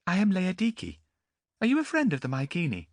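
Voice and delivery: put-on young noblewoman asking a stranger; Laodike's voice. A narrator's reading. Young woman Laodike speaking.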